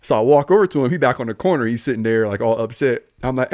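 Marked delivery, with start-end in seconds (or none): none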